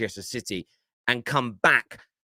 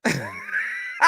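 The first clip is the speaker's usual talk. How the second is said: high-pitched helpless laugh; real high giggling fit; laughing hard; 3 dB quieter than usual. Real, not acted. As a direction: crack up at someone's pain. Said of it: "do you know why I'm laughing so hard".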